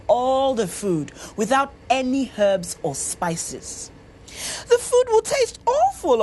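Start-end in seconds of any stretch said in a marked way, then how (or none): 4.7-6.2 high, loud protesting cook; objecting. A narrator's reading. Cook objecting to Anyowo's order, high and protesting. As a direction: push back on the odd order. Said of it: "objected the cook".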